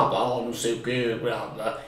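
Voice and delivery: low, quiet, gruff cartoon mouse; deep mouse voice. Shown as a demonstration. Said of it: "ele geralmente não vai falar". (voz grave de ratinho, baixa e grossa)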